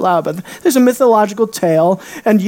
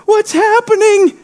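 much higher, panicked cry; mock panic; 12 semitones higher, 3 dB louder than usual. Panicked believer crying out to God, much higher. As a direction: squeal it in alarm.

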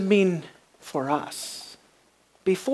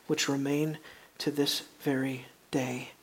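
hushed whisper; 4 semitones lower, 9 dB quieter than usual. Real, not acted. Hushed close of the cave story.

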